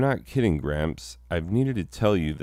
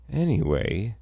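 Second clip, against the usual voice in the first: ordinary delivery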